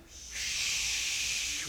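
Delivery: drawn-out shh as wind; wind noise. Pretend. Preacher cues storm effects, long hissing wind noise. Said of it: "so we need wind".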